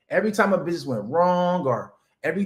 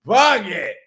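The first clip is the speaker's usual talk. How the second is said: mock careless brush-off; 3 semitones higher, 7 dB louder, much slower than usual. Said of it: "and I said".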